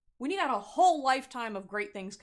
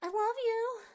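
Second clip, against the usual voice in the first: much higher, gushing lovesick voice; mock love bomber; 13 semitones higher, 5 dB louder than usual. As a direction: overdo the lovesick ex, pitched way up. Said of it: "coming back being like".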